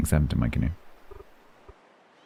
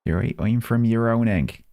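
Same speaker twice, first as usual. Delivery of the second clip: higher-pitched put-on regional accent; Ernie's accent; 6 semitones higher than usual. Gamer acting a character introducing himself, high put-on accent. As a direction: play a local in a lilting, higher character accent. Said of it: "in an Ernie's accent".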